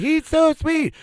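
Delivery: very high cooing gush; gushing moms. Retold or imitated. Moms gushing about Bill, high cooing voice. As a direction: swoon like the Malibu moms. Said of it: "They're like".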